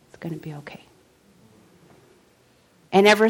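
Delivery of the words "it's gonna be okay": very soft, whispered promise; whispered reassurance. Retold or imitated. Jesus speaking as fires broke out, whispered reassurance. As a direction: barely voice it, as comfort from within.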